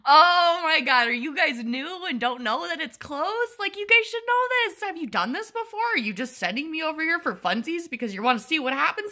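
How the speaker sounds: high, incredulous sarcasm; mock the staff who sent her; mock exasperated rant. Imagined rant at the absent lab staff, high and sarcastic.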